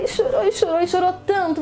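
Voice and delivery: ordinary delivery, nothing marked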